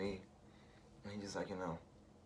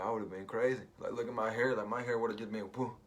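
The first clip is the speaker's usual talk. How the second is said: louder, excited, picturing his own hair going wild; excited outburst; 4 semitones higher, 6 dB louder than usual. Real, not acted. Telling his coworker he would have gone crazy, louder and excited. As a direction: get worked up at the thought of the chaos. Imagining himself losing it.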